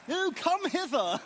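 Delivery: high-pitched, hammed-up British accent; mock British accent. Jokes about preaching in British accent.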